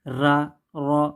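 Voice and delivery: را drawn out very slowly; slow demo. Shown as a demonstration. Teacher slowly repeats the letter ra. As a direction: stretch out را, rolling the r. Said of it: "یہ ہے را".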